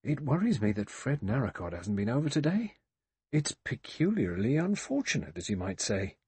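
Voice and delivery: ordinary delivery, nothing marked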